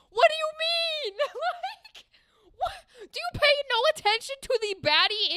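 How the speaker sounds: high disbelieving what; can't believe the question; incredulous. Real, not acted. Streamer to game character, high incredulous disbelief.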